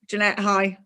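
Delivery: ordinary talk, nothing marked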